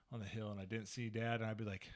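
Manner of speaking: ordinary talk, nothing marked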